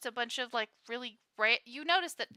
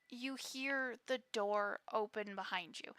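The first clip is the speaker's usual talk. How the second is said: ordinary delivery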